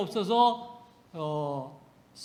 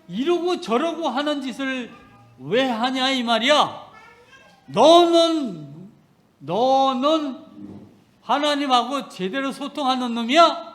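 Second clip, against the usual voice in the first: thundering rebuke; 5 semitones higher, 7 dB louder than usual. Retold or imitated. Preacher voicing God rebuking him directly, loud and stern. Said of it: "책망으로 확 들리는 거예요".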